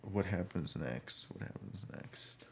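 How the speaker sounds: soft, slow mumble fading to a whisper; absent-minded muttering, trailing into near silence; murmured to self. Real, not acted. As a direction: think aloud under the breath. Murmuring to self, finding their place.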